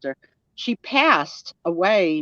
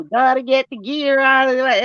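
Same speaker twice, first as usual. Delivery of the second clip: put-on Southern accent; 7 semitones higher, 4 dB louder than usual. To a friend, imitating her Georgia brother's Southern accent. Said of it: "he talks like".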